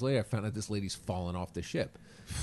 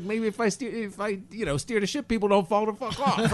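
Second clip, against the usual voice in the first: higher, laughing jab; cocky comeback; 10 semitones higher, 4 dB louder than usual. Retold or imitated. Comedian mocks the captain for losing a passenger, laughing jab. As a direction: land the punchline on the captain.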